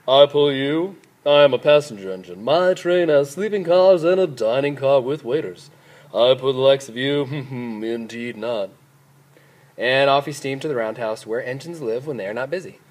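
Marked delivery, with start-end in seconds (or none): 0.1-8.7 haughty shiny engine, scoffing laugh; snorting disdain. A narrator's reading. New engine refuses the broken-down train, snorting with disdain. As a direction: scoff at them like a self-important engine. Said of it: "the shiny new engine snorted".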